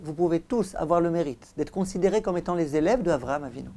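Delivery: ordinary talk, nothing marked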